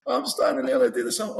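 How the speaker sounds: pitched high in disbelief; laughing disbelief at himself; incredulous. Real, not acted. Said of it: "repeated with rising disbelief".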